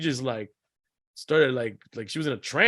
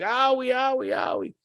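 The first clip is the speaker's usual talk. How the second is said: put-on high, whimpering owie in a child's voice; whiny kid complaint; 11 semitones higher than usual. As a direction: whine like a little boy with sore legs. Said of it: "really seriously compl".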